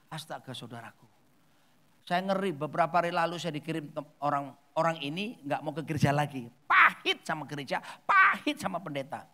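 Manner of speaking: ordinary delivery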